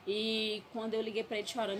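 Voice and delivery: ordinary delivery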